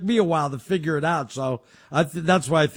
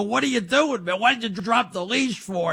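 retold yell at wife; 6 semitones higher than usual. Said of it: "I'm yelling at my wife".

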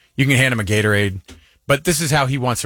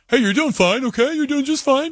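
high-pitched, mock-cheery parent encouragement; overeager reassurance; 13 semitones higher than usual. Parent reassuring a golfing kid from the sidelines, high and overeager. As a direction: chirp it way up high, falsely upbeat.

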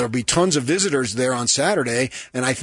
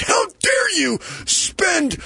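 high-pitched mock outrage; nasty message. Editor leaves nasty message about credit card, mock-angry scolding. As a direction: mimic an indignant editor scolding down the phone. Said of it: "leaves a nasty message".

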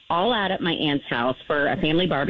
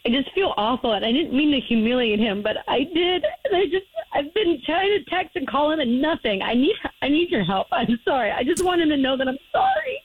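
real tears, words breaking up; crying; 6 semitones higher than usual. Real, not acted. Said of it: "I didn't think I would get this".

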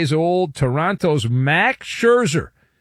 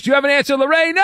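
bright, raised quiz-host call; higher playful call; 11 semitones higher, 4 dB louder, much faster than usual. Acted. Host calling on caller Lorena, raised playful voice. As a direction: put the question to her up high.

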